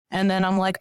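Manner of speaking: normal talking voice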